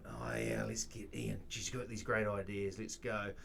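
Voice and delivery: soft, deflated mutter of a retold thought; enthusiasm draining away. Younger guy losing heart in a pitch meeting, deflated. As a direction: let the eagerness drain out quietly. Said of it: "I could see his enthusiasm going".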